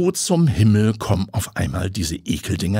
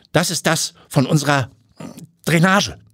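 stammering; 8 semitones higher than usual. Acted. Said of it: "bringt sie stotternd hervor".

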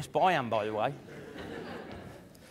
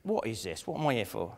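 much softer, muttered inner doubt; imagined doubtful thought; 9 semitones lower, 12 dB quieter than usual. Retold or imitated. Speaker voicing an audience member's inner doubts, very soft. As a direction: voice the listener's quiet confusion. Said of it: "you might be thinking".

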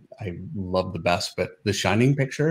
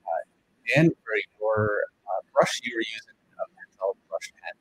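breaking up, fading in and out; bad connection, voice cutting out; call dropping out; 3 dB quieter than usual. Real, not acted. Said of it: "your sound is going out a bit there".

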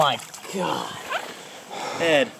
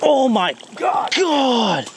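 excited shout; 11 semitones higher, much slower than usual. Real, not acted. Huge fish surfaces near boat.

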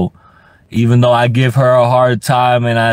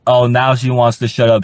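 normal talking voice

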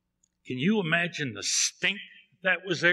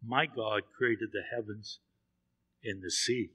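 lightly voiced prophet, calm and a little lower; 6 semitones lower, 7 dB quieter than usual. A narrator's reading. Preacher voicing Jonah calmly answering the sailors. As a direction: say it steady and unhurried, a touch lower. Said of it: "Very calmly".

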